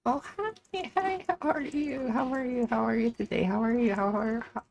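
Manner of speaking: high, chirpy customer-service greeting; sweet cashier voice. Real, not acted. Cashier chirping hello to a customer.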